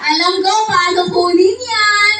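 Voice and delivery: high, slow pleading call of a madman; madman begging. Retold or imitated. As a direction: call out to the doctor like an eager lunatic. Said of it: "Okay, sabi niya".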